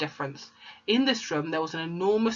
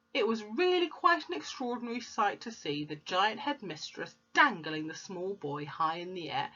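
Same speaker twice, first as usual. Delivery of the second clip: ordinary delivery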